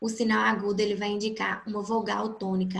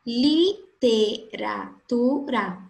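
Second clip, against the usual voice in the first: slow syllable count; 4 dB louder, much slower than usual. Shown as a demonstration. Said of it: "Avenida possui quatro".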